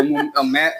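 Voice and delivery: ordinary delivery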